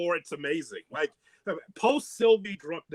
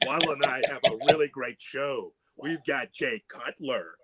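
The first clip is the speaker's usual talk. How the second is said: mock a boozy [name] introducing the show; drunk Sylvie; 4 semitones lower than usual. Co-host mimicking drunk colleague introducing the show, low drunk voice. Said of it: "the voice goes completely low".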